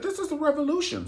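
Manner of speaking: mock rioter. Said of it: "She said".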